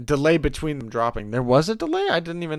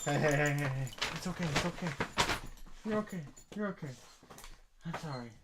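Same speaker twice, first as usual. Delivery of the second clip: very soft, hushed reassurance to a dog; calm the scared puppy down gently; soothing; 14 dB quieter, faster than usual. Real, not acted. Soothing a frantic puppy in her lap, very soft.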